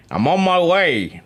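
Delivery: put-on slow, hammy New York accent; mock New York accent with a Welsh hint. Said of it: "in his newfound New York accent".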